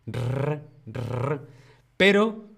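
ordinary delivery

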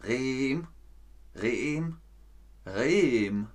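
"Rehm" tried as a drawn-out, questioning single syllable; trying out pronunciations. Real, not acted. Teacher asking how a viewer's name is said, trying out pronunciations. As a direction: sound out "Rehm" three times, varying the tune. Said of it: "Wie spricht man deinen Namen aus?".

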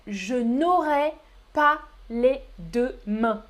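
very slow, word by word; slow full form. Shown as a demonstration. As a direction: separate every syllable of the phrase. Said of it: "ou plus rapidement". (très lentement, syllabe par syllabe)

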